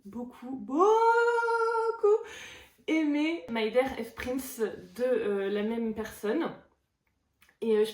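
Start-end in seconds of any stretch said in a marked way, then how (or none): 0.1-0.6 softly said "beaucoup", holding back; soft build-up before the outburst. Real, not acted. Vlogger gushing about a loved romance, softly. 0.6-3.4 "beaucoup" high, loud, first syllable stretched out; gushing outburst. Real, not acted. Vlogger gushing about a loved romance, high drawn-out outburst.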